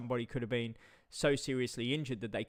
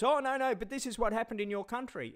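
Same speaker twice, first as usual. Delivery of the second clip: much higher, lightly put-on mock protest; mocking viewer voice; 9 semitones higher than usual. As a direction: brush off the viewer in a squeaky sneer. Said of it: "like Sapporo J goes".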